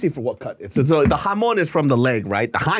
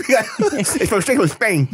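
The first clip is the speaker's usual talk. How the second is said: high mock Castilian lisp; lisping Spanish accent; 7 semitones higher, slower than usual. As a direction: overdo a lisp from Spain. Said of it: "the lisp".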